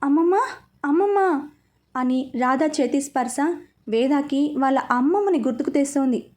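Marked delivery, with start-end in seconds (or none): 0.0-1.5 delirious murmur. A narrator's reading. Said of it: "ఏవో కలవరింతలు".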